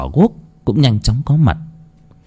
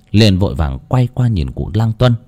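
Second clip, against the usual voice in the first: plain reading voice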